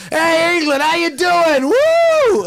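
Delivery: high, loud hammed-up stage greeting; mock American rock singer. Mocking American bands greeting a British crowd, hammed up. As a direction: ham up an American frontman. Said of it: "They stand there, they go".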